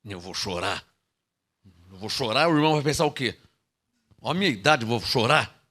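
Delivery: a hint of an embarrassed old man; mock proud believer. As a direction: imitate a vain brother worried about looks. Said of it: "Alô?".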